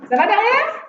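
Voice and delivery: ordinary delivery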